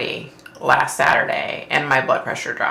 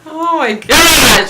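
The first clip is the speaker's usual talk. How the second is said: laughing exclamation; 12 semitones higher, 7 dB louder, much slower than usual. Real, not acted. Laughing at the husband's joke.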